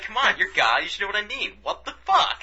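demanding answers from God, rising to a laughing squeal; building exasperation. Retold or imitated. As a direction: build from pleading to an incredulous squeal. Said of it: "It's like".

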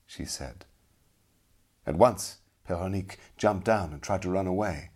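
plain reading voice

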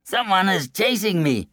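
high-pitched fleeing braggart; boastful man. A narrator's reading. Runner answers the villagers' question, high boastful man's voice. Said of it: "replied the boastful man".

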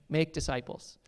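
usual speaking voice

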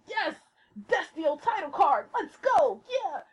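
high, gleeful fan cheer; reenacted giddy viewer reaction; excited cheering. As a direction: celebrate each bit as it appears. Retelling her cheers while watching.

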